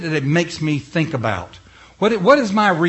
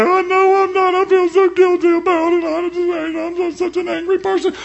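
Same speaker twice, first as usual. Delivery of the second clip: whiny guilty believer; 6 dB louder than usual. Retold or imitated. Preacher to congregation, mocking a guilty believer in a high whine. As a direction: whine it like a self-pitying churchgoer.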